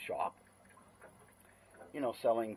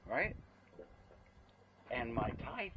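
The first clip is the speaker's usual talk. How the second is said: ordinary talk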